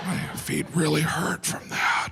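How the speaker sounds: whispered mock whimper; whiny dancer. Comic imitating tired flamenco dancers, whimpering. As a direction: whimper it like sore feet. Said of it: "they're like".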